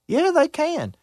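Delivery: plain reading voice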